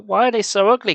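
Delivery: loud, high frightened question; alarmed cry. A narrator's reading. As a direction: blurt it out in alarm. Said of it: "Carly Beth started".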